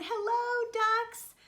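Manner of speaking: high, loud drawn-out hello; mouse calling out. Acted. Mouse at pond's edge greets the ducks, high call. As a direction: call across in a high little voice.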